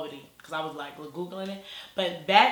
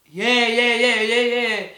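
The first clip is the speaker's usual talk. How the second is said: high, loud run of yeahs in a put-on voice; hammed-up, loud and high imitation; imitating the brother; 5 semitones higher, 11 dB louder than usual. Brother answering her question, loud put-on voice.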